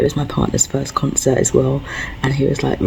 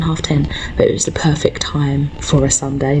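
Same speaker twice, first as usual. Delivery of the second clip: whisper. Real, not acted. Whispering at work.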